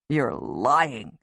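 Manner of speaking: low, hurt man's voice; lightly voiced wounded husband. A narrator's reading. Griff accusing Tony, low and wounded. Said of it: "Griff says in a wounded voice".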